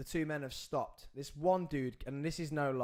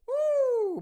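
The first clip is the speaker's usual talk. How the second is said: very high, loud whoop of relief; relieved whoop; 11 dB louder than usual. Retold or imitated. The big man storms off and Sam lets out a very high relieved whoop. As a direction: let out a giddy cry of relief.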